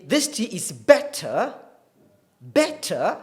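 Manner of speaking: British "better" with a crisp T and no final R; British RP accent. Shown as a demonstration. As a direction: stress the T consonant in "better", British style. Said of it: "the British R P accent".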